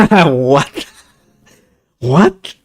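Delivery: loud, high double what; disbelieving what. Real, not acted. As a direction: exclaim in disbelief. Disbelief at game result.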